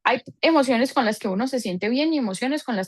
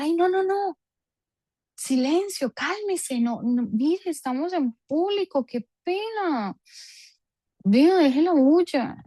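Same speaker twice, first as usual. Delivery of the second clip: put-on high, fussy parent shushing a child; hushing parent; 7 semitones higher, slower than usual. Embarrassed mother shushing her excited child in public, high and fussy. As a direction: hush the kid, embarrassed in public, pitched way up.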